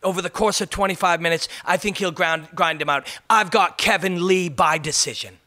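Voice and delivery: mock English fight pundit making his pick; Michael Bisping. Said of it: "this is the worst accent ever".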